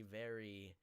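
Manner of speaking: ordinary delivery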